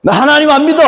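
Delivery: put-on high, loud childish protest; child's defiant shout. Child rejecting street evangelism, shouting high. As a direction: yell it like a stubborn toddler refusing. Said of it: "이렇게 얘기를 한 거예요".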